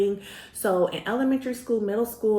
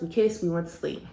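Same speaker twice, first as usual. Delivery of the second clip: normal talking voice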